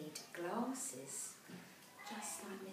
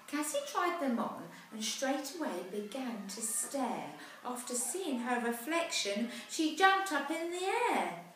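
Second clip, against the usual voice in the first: put-on lively rhyming read-aloud for children; storybook narration; 5 semitones higher, 10 dB louder than usual. A narrator's reading. Parent reading a rhyming picture book aloud, lively. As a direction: tell the rhyme brightly to a child.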